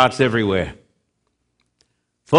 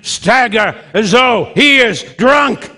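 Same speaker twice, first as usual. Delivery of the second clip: preaching shout; 8 semitones higher than usual. Real, not acted. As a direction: thunder it out from the pulpit. Preacher shouting the Bible's image.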